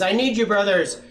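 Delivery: high, loud, urgent plea; frantic. A narrator's reading. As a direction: cry out for help in a panic. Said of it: "she panted, frantic".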